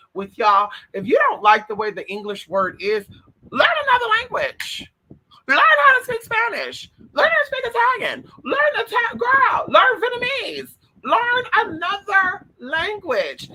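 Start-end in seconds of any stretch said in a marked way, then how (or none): 3.5-13.5 high, loud exasperated yelling; ranting shout, pitched way up. Real, not acted. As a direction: belt it out at the audience, fed up. Ranting about people inventing words.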